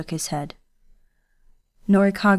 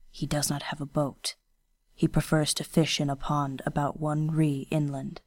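lightly voiced stern young ronin, hushed; a hint of a guarded swordsman speaking low; quiet, restrained ronin voice; Kenishi, low and quiet; 3 semitones lower, 7 dB quieter than usual.

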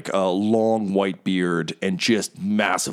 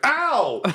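yelp of pain. Acted. Flute hits her head.

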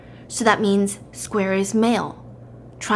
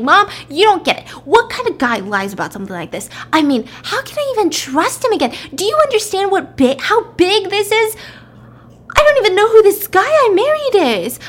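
high-pitched ranting that climbs higher and louder; 9 semitones higher, 3 dB louder than usual. Retold or imitated. Wife ranting to her mom on the phone about her husband. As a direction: rant in building disbelief. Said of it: "just ranting to her mom".

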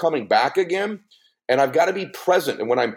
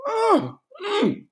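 high mock moans Mm mm, pitched way up; imitated pleasure moans. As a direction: hum Mm mm high like a wife savouring food. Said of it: "she'll do it in restaurants".

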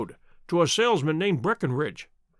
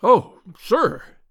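louder, quivering with emotion; emotional cry; 3 semitones higher, 7 dB louder than usual. A narrator's reading. Ryder to Holmes about the goose, crying out with emotion. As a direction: cry it out in agitated hope. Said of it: "he cried".

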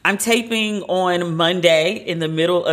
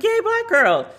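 cheering; slower than usual. Retold or imitated. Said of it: "I was like".

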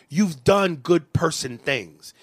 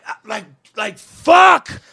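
a sudden, much higher, louder yell of fuck; a real, much higher and louder frustrated shout; shout of frustration; 4 semitones higher, 8 dB louder, slower than usual. Real, not acted. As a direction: let it all out in one yell. Venting about life's frustrations.

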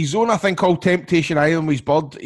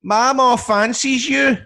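put-on child's cheeky voice; 10 semitones higher, 4 dB louder, much slower than usual. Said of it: "and I went".

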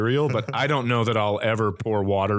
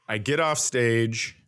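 ordinary talk, nothing marked

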